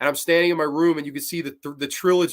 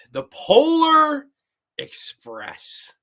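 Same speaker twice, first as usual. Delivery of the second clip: mock dramatic; 11 semitones higher, 6 dB louder, much slower than usual. Announcing the dreaded movie title.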